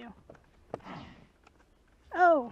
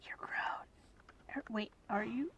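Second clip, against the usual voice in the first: whisper; 8 dB quieter, slower than usual. Real, not acted.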